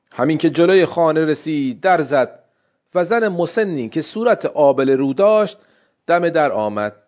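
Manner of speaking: usual speaking voice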